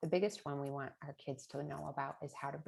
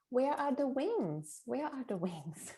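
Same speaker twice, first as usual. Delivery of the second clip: put-on high, drawn-out little-kid wail; small son; 8 semitones higher, 4 dB louder, slower than usual. Parent imitating son looking out the taxi window, high childlike voice. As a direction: imitate a puzzled small child, pitched up and slow. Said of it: "I remember my son at age three and a half".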